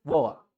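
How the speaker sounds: "वाटर" as a low "wo-uh"; British-style "वाटर" with a catch for the t; British dropped t. Shown as a demonstration. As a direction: leave out both t and r in "वाटर". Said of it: "Britain में क्या बोलते हैं".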